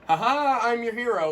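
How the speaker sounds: high, loud triumphant proclamation; imitating a grandstanding hero; self-styled hero. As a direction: announce yourself like a showboating rescuer. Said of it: "he was like".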